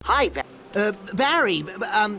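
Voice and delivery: nervous calling out. Acted.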